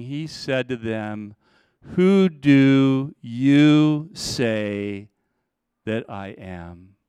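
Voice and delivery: drawn out, each word weighed; slow solemn question. Acted. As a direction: let the question hang slowly. Preacher slows the key question.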